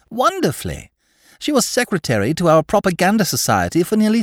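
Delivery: high, fervent protesting; lightly voiced earnest idealist; eager young woman. Tarrant defends Mary eagerly.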